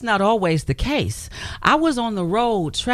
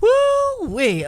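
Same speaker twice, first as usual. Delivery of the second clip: exclaiming. Real, not acted. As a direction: whoop at a painful memory. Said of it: "reacting to painful memory".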